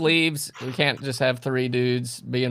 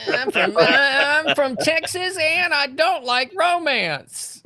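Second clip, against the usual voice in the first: high, slow hammed-up Texan drawl; mock cowboy trash can; 14 semitones higher, much slower than usual. Reading the cowboy trash can's line to friends, mock drawl. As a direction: play a trash can in a cowboy hat, lightly. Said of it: "imagine the trash can wearing a cowboy hat".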